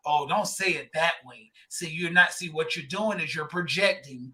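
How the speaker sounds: mock the people who say it's projecting; mocking the sensitive crowd. Mocking critics who police how men talk.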